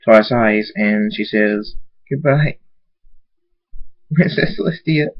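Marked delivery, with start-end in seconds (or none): 2.1-5.1 high voice cracking on the farewell; a small, teary goodbye to a lost princess; tearful goodbye. A narrator's reading. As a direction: say goodbye through tears, voice breaking. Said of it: "Tears fall from Twilight's eyes".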